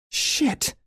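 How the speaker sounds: shocked shriek. A narrator's reading. Erica realizing Sarah saw the camera, shriek. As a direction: yelp it in panic. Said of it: "Erica shrieks".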